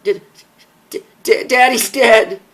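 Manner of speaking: stammered, then a high loud cry; sobbing daughter forcing out the news; forced out crying. Acted. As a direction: choke on the words through tears. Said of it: "forced the words out as she began to shake as she cried".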